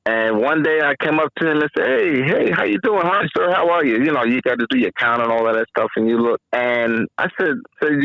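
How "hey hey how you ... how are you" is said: friendly greeting. Retold or imitated. Officer greets inmate cheerfully.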